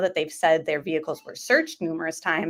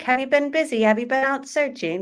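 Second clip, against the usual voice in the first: higher-pitched, badly faked British accent; hammy British accent, pitched up; terrible British accent; 7 semitones higher than usual. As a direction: mimic the caller's questions in bad British. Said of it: "terrible British accent".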